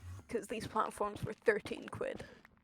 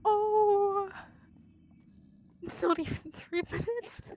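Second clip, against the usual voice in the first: high startled laughing outburst; gasp, then laugh it out; surprised laugh; 10 semitones higher, 5 dB louder, slower than usual. Real, not acted.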